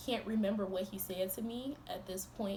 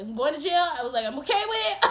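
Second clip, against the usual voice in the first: laughing; 7 semitones higher, 11 dB louder, faster than usual. Retold or imitated.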